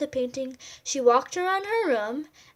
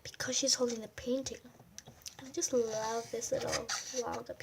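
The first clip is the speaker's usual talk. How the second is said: whisper; 11 dB quieter than usual. Real, not acted. Reader whispers aside.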